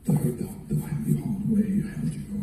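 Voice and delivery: hushed, urgent whisper; whispered warning. Retold or imitated. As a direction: whisper it like a friend tipping you off. Secret Service buddy warning quietly.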